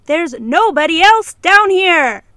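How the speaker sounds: loud and slow; loud protest. Acted. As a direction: call out across the empty archive. Protesting the library voices rule.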